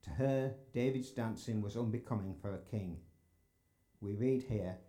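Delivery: usual speaking voice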